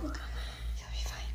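slow soft whisper close to her ear; whispered reassurance. Real, not acted. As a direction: hush her gently, very slowly. Mother soothing crying daughter.

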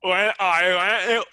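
loud, hammed-up wide-mouthed voice; mock over-articulating guy, loud; exaggerated mouth-opening. Shown as a demonstration. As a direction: overdo the open mouth comically. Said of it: "be like this guy".

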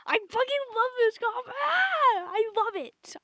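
squealing fangirl shout; ecstatic gush. Real, not acted. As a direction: gush with delight. Gushing excited outburst.

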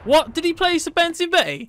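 high, loud disbelief; sudden shocked shout; shocked outburst. Real, not acted. Shocked by enemy killstreak.

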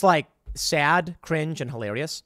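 normal talking voice

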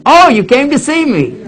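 high, pleased greeting; a confused old man delighted to see his son; forgetful father. Retold or imitated. Father greeting his son entering the room, high and pleased. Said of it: "I'd come back in".

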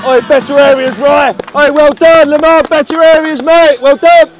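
loud, high calls across the pitch; touchline shout. Real, not acted. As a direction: bellow instructions to players. Coach calling out during play.

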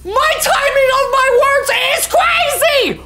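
high, slow, shrieking disbelief; shocked shriek. Real, not acted. Shocked streamer yelling at game.

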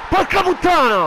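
furious shout; angry outburst. Real, not acted. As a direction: yell it in real frustration. Reacts to conceding fifth goal. (urlo furioso)

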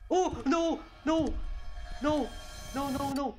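high frightened screams of no; panicked screaming. Real, not acted. Panics at a jump scare.

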